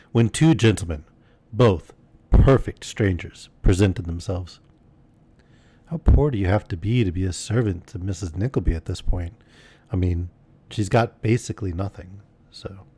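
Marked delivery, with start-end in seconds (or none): none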